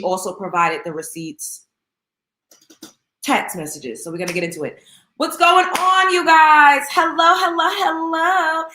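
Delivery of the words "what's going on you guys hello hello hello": loud, high welcome to the viewers; pumped-up stream opener, louder and higher; hyped greeting. Real, not acted. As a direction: shout a big hello to the chat. Hyped greeting opening the live.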